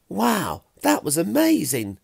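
high, loud burst of amazement; excited cry. A narrator's reading. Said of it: "Pilk cried".

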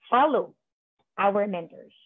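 ordinary delivery

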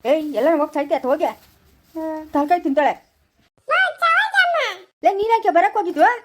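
irate quarrelling shout; angry scolding. Acted. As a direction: scold them at rising volume. Acted family quarrel at hospital.

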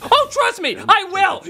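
ordinary delivery

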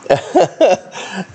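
high giggle; embarrassed laugh. Real, not acted. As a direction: laugh it off, caught eating. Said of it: "you can hear me crunching".

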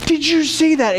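high, excited wonder; amazed crowd. Retold or imitated. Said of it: "those gasps have changed to".

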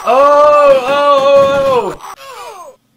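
high, loud drawn-out "oh"; loud yell of dismay. Real, not acted. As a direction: cry out as a soldier is lost. Loses a soldier in game.

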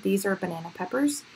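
ordinary talk

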